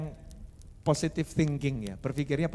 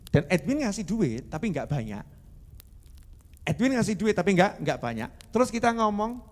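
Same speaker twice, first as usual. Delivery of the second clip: usual speaking voice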